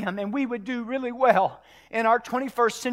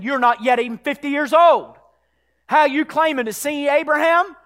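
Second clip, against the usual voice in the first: raised voice climbing to a loud, high climax; building preaching; 8 semitones higher, 12 dB louder than usual. Acted. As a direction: build steadily to a shouted declaration.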